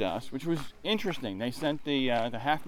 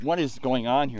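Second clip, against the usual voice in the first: ordinary talk, nothing marked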